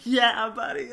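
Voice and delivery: cheering. Retold or imitated. Everybody cheering in retold scene.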